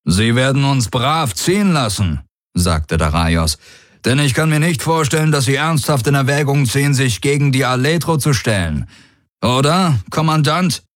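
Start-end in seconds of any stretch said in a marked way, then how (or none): 0.0-2.2 high, lifted commander voice; confident warlord. Acted. Daraios assuring the commander of his oath, high and confident. 4.0-10.8 high, brisk, assured commander; challenging warlord. Acted. Daraios daring the commander to oppose him, high and assured.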